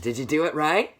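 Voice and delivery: usual speaking voice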